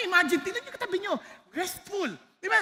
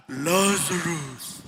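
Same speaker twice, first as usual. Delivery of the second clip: very low, very slow booming call; mighty archangel voice; 11 semitones lower, much slower than usual. Retold or imitated. Preacher imagining the archangel's call in heaven, deep slow boom. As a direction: let the name roll out like a heavenly voice. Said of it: "imagine yung lakas na yun, archangel".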